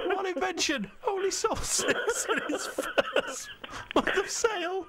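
reading the question through real giggles, pitched high; fighting back a laughing fit. Real, not acted. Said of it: "You've got me going".

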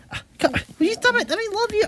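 playful high plea; high-pitched pet coaxing. Real, not acted. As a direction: coax a squirming pet in a high voice. Coaxing a squirming pet for affection.

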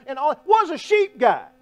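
loud, high, driving preacher voice; preaching at full force. Acted. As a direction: preach it out hard to the room. Preacher raising voice to congregation.

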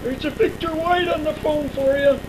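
turned away, distant from the mic; away from the phone. Real, not acted. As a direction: call across the room, off-mic. Talking to someone off the phone.

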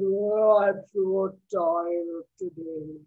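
slow, low mooing drawl; lowing cow, dragging each word; tired cow. Acted. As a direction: moo it out wearily. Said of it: "And the cow says".

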